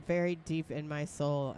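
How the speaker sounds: put-on upper Midwest accent. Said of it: "I love that upper Midwest accent".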